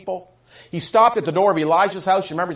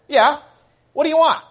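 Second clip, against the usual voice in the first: loud, high, offhand challenge; brusque reply; 8 semitones higher, 7 dB louder than usual. Acted. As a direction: answer the door rudely, voice pitched up. Acted rude answer to important caller.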